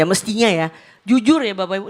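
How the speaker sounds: normal talking voice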